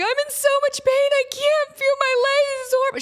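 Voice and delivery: put-on very high, wailing child in agony; hammed-up tearful squeal; mock freak-out. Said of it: "She's freaking out".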